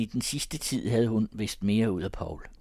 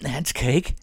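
higher, louder defiant refusal; stubborn; 7 semitones higher, 7 dB louder than usual. A narrator's reading. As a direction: dig in and refuse. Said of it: "sagde Paul stædigt".

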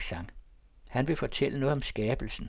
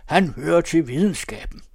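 loud, raised proclamation; high, forceful public declaration; 5 semitones higher, 9 dB louder than usual. A narrator's reading. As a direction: proclaim it over the uproar. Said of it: "siger han meget højt".

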